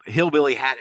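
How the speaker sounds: ordinary talk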